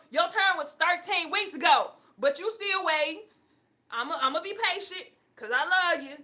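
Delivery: loud, high, laughing mock rant; exasperated divine telling-off; scolding God voice. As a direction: scold them as a fed-up God, half laughing.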